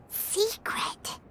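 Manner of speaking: hushed, secretive whisper. Acted. Confiding someone's secret aloud.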